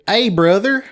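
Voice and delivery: high, loud, exaggerated Eastern Kentucky twang; over-the-top Eastern Kentucky greeting; thick Eastern Kentucky accent. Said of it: "compared to just folks around here".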